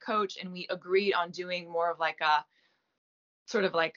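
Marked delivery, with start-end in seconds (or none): none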